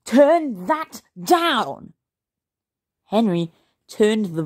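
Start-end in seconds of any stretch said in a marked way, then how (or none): 0.1-1.9 very slow, high, exasperated yell; yelling dad. A narrator's reading. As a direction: yell each word separately. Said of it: "yelled Dad".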